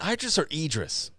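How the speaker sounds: "Idris" two ways, EYE-dris or EE-dris; two pronunciations compared. Shown as a demonstration. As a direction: say Idris first with long EYE, then with EE. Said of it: "I say that wrong. Is it".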